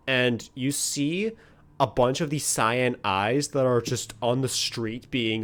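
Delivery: normal talking voice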